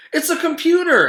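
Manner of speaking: exclamation. Acted. Teacher unboxing the package, exclaiming loudly. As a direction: cry it out with delight. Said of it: "exclaimed Missus Jules".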